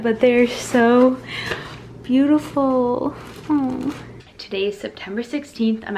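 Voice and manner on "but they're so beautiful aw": higher, slow, drawn-out gushing; touched admiration. Real, not acted. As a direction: coo over a lovely surprise gift. Admiring a surprise doorstep delivery.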